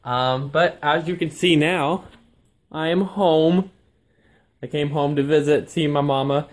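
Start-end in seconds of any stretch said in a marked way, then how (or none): none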